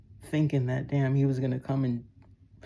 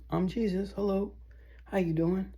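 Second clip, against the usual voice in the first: higher, lightly sweet public voice; a pretend polite hello, pitched up; mock public greeting; 6 semitones higher than usual. Lightly mimicking how he'd greet strangers in public, pitched up. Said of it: "tell y'all in public".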